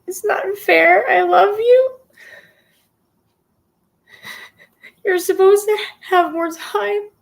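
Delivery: crying, voice breaking high; sobbing through the words; tearful goodbye. Real, not acted. Live eulogy addressed to dying friend.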